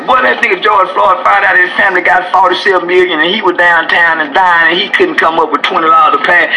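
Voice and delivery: ordinary talk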